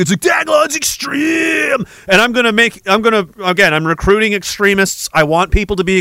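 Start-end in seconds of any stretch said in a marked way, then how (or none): 0.2-1.9 very high, long-drawn promo scream; over-the-top meme shout, slow and high; mock extreme-sports hype. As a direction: yell it like a hammed-up action-sports ad. Parodies meme hype again.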